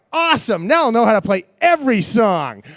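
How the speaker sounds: like you just discovered a superpower; excited. Retold or imitated. Excited self-quote on tambourine.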